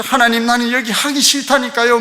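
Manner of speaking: normal talking voice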